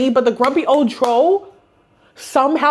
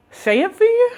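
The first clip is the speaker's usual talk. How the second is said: disbelief; 11 semitones higher than usual. Retold or imitated. Retelling her shocked reaction.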